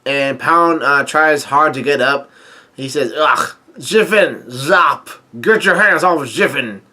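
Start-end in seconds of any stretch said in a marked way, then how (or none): 3.1-6.8 imitating slurred words after a punch; garbled speech. Pound tries to speak after the punch, garbled mock protest. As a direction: struggle to get the words out, mangling them. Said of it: "He tries to speak, uh, correctly, but he can't".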